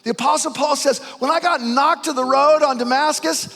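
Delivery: very loud, high, slow proclamation; full-throated preached cry; shouting preacher climax. Acted.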